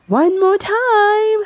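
high, loud, drawn-out cheer, slow. Acted. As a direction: stretch out a joyful cheer. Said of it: "Mowgli cheered".

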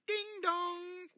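high sung ding dong like a bell; doorbell chime. Acted. Reader chiming the doorbell for children.

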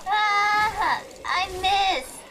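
frustrated shout. Real, not acted. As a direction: yell out at the miss. Misses attack in game.

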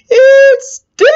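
high, loud horrified shriek; imitated girl's scream. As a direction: shriek it like a horrified little girl. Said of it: "the little girl screamed and said".

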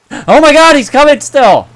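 high, loud frightened yell; panicked scream. Real, not acted. Gamer seeing a grasshopper charge him, panicked scream. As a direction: shriek as the bug charges.

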